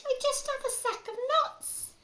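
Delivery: very high, loud, slow squeaky squirrel; a small squirrel pleading; frightened squirrel. Acted. Parent voicing the squirrel answering the robber rat, squeaky high. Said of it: "the squirrel replied".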